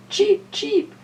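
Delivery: tiny high bird chirps; chirping robins. Acted. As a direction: squeak it like baby birds. Robins cheeping in story.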